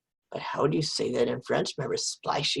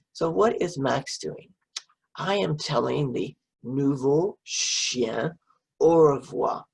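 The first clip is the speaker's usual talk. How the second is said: ordinary delivery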